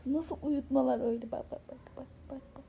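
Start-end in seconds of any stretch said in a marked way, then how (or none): 1.3-2.6 breathy whispered coaxing. Real, not acted. Mother whispering softly to her pet. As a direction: whisper so as not to startle it.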